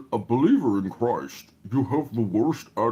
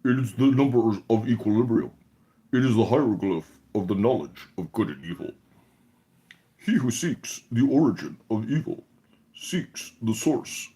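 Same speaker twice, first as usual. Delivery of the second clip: ordinary delivery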